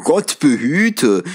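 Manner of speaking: slow, stunned gasp of disbelief; as if the air were knocked out of him; breathless astonishment. Acted. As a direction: gentleman stunned by Scrooge's donation. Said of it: "als bliebe ihm die Luft weg".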